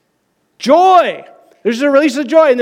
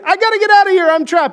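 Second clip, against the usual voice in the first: much higher, frantic trapped cry; hammed-up movie panic; panicked movie guy; 11 semitones higher, faster than usual. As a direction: play the doomed guy bolting in panic. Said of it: "the guy's like".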